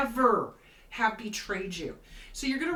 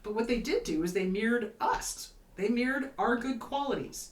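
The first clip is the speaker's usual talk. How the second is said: ordinary talk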